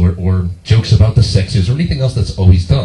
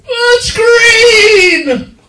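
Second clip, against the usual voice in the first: very high, loud wail; imitated mom shrieking in outrage; mother's shriek; 5 dB louder than usual. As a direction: scream it in drawn-out falsetto horror. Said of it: "when I heard her go".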